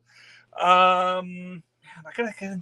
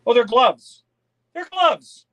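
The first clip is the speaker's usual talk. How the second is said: surprised; 5 semitones higher, 8 dB louder, slower than usual. Real, not acted. Surprised opening the package.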